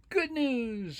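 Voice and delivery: high, sing-out announcement; upbeat storybook exclamation; excited rabbit. Acted. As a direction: announce it brightly to little listeners. Rabbit announces good news.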